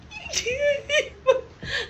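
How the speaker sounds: high laughing mock sob; cry-laugh like a tantrum kid; fake wailing. Fake child crying.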